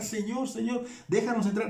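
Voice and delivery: high, pleading cry of a shut-out virgin; desperate pleading. Retold or imitated. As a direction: beg to be let in, a touch desperate. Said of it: "lo mismo dicen ellas acá".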